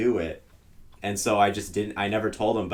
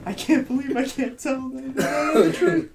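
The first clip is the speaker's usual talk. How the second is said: put-on high, drawn-out kid whine; high childish lament; kid in disbelief; 13 semitones higher, much slower than usual. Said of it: "as a kid, I was like".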